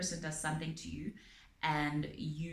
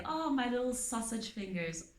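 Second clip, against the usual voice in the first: higher, sugary teasing pet name; mock sweet teasing; 6 semitones higher than usual. Abuser teasing partner about fingers, sugary mock sweetness. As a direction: coo it like a fake compliment.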